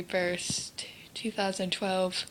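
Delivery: hushed, voiceless whisper; whispered sad memory. Real, not acted. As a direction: barely breathe the words out, holding back tears. Sad story of grandmother's illness.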